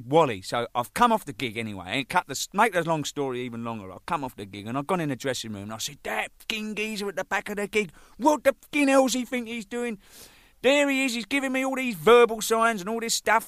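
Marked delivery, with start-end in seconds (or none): 6.0-13.5 high-pitched, exasperated complaint; retold indignant rant. Drummer complaining in the dressing room, retold indignant rant. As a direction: rant in outrage, pitched well up.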